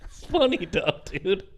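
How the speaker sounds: real laughter breaking through the words; giggling while saying It's funny; laughing. Real, not acted. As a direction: laugh it out, high and amused. Laughing at friend's complaint.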